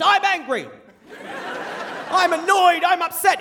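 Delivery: high-pitched hammed-up yelling of feelings; mock scream. Dad yelling his feelings instead of swearing, high mock scream. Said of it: "hear this scream of just".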